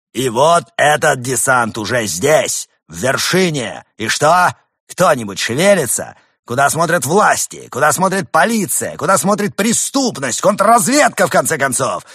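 high, loud outraged rant; ranting demagogue. Acted. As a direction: whip up the crowd.

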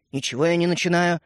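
ordinary delivery, nothing marked

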